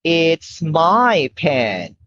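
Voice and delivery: slow, clear model sentence. Shown as a demonstration. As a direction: say it slowly for a toddler to copy. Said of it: "Now you say".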